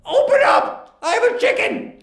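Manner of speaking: very high, put-on caller voice; prankster's voice. Prankster calling to the poet at his door, high put-on voice. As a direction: call through the door in a high silly voice.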